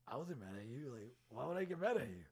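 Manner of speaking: mock-defensive, incredulous; retold protest. Retold denial of being mad.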